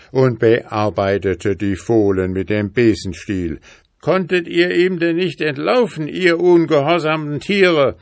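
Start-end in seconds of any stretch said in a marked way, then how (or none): 4.0-8.0 angry scolding. A narrator's reading. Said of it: "rief sie zornig".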